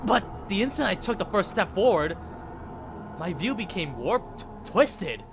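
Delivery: story voice swept into the twist; dramatic narration. A narrator's reading. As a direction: draw out the dizzy moment. Narrating the hero's warp.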